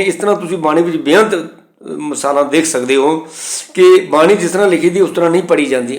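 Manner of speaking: ordinary talk, nothing marked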